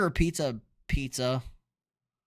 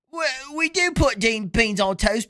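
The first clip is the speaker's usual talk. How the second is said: slow, high put-on British voice; drawn-out British parody, pitched up; mock British accent; 5 semitones higher than usual. Streamer playing a Brit defending beans on toast, mock British accent.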